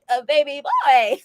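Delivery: lightly put-on catchphrase voice; playful put-on voice. Host echoing co-host's catchphrase, playful put-on voice. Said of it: "that that voice just comes out".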